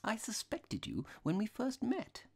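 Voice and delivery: high-pitched apologetic priest; mild little priest. A narrator's reading. Priest admits suspecting the robber, apologetic. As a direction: explain meekly in a high voice.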